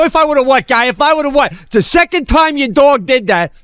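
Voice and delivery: high-pitched, indignant yelling; retold angry challenge. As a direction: confront the dog owner. Retold argument with dog owner.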